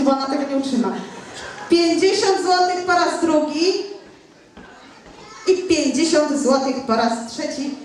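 brisk auctioneer calling bids. Acted. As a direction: rattle off the bids.

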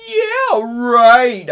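very high, loud, mocking squeal; exclaimed. Friend disbelieving Ebony's denial, exclaimed. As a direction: scoff it at full squeal. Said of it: "I exclaimed".

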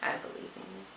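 a soft, unvoiced whisper of belief; whispered encouragement. Real, not acted. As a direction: breathe it gently to the viewer. Encouraging viewers.

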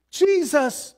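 high, loud desperate yell. Retold or imitated. Blind beggar yells for Jesus over the crowd, loud cry. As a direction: call out for him over the crowd. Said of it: "he yells more".

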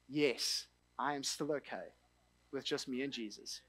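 quietly sincere vow to God; tender surrender. Retold or imitated. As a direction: let it fall to an intimate hush.

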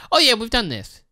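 hammed-up high voice of YouTube brushing off complaints; mocking YouTube. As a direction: play a company pretending it was always this way, high and quick. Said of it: "YouTube is just like".

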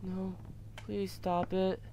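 a soft, pleading near-murmur; softly pleading to the screen; quiet plea. Real, not acted. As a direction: beg it under your breath. Pleading while scared in game.

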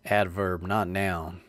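ordinary talk

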